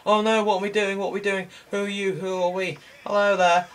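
high, sing-out cartoon voice; high-pitched mock panic; mock confused characters. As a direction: voice clueless figures in a high pitch. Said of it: "Just like".

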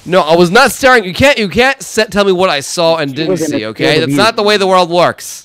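loud, high indignant rant; heated rant. Real, not acted. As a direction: argue back, fully indignant. Arguing he never saw enemy.

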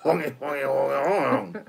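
silly hammed-up French gibberish; mock French accent. Mocking the French to his friend, hammed-up French gibberish. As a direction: ham up a fake Frenchman, chanting. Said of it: "Every time I speak French".

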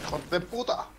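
sharp high exclamation; frustrated outburst. Real, not acted. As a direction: blurt it out in frustration.

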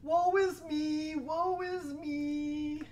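moaning troll. Acted. Troll bemoans his headache and hunger, whining. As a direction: whine it like a sorry troll.

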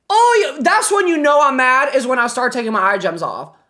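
ordinary talk, nothing marked

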